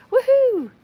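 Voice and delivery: high, loud woohoo; celebratory whoop. Real, not acted. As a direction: whoop it up for the viewer. Cheering a viewer's level-up.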